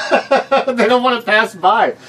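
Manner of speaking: slow, high, laughing. Real, not acted. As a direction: say it through laughter. Laughing while telling.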